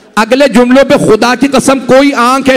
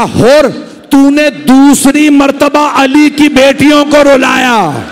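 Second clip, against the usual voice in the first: self-reproaching lament; 6 semitones higher, slower than usual. A narrator's reading. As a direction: cry out against himself in remorse. Hur laments, beating his head.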